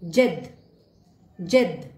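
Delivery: जेद said as zed in French; French letter Z. Shown as a demonstration. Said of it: "pronunciation है".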